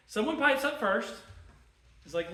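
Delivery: normal talking voice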